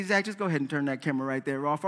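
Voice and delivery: low, quick aside to the camera man; hushed quick aside; mock aside. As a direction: toss it off softly. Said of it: "I am more than happy to say".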